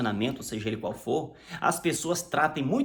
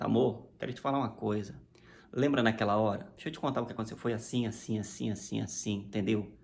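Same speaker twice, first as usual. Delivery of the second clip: calm explaining; 7 semitones lower, 5 dB quieter than usual. Retold or imitated. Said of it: "agora os ânimos estão tranquilos".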